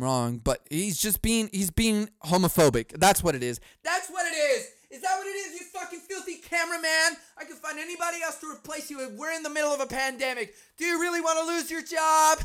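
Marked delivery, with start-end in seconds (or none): 3.8-12.4 high, sneering celebrity voice; spiteful star threatening the cameraman's job; mock diva rant. Voices Ellen berating cameraman.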